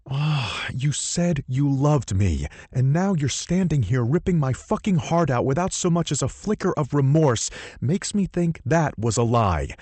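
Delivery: rip it out of your chest; heartbroken anger. Acted. Hero's heartbroken angry speech.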